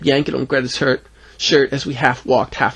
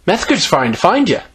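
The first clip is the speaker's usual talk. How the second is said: put-on high child voice, matter-of-fact; casually inform in a girlish pitch; 7 semitones higher, 3 dB louder than usual. A narrator's reading. Greta passes on news to Bill, matter-of-fact child voice. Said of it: "Greta said matter-of-factly".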